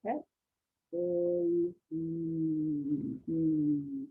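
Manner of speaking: "K", "Oi", "Mm" as separate low sounds, the last held; sounding out. Shown as a demonstration. Teacher sounding out coin, "K", "Oi", "Mm" one by one. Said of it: "You hear that last sound?".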